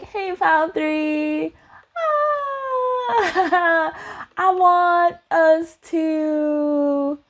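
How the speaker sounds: high, drawn-out hesitant greeting; awkward crush approach. Acted. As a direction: stretch it out high, with an awkward laugh. Acting out crush's nervous approach.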